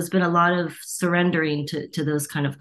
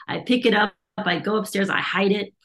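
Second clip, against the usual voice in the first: normal talking voice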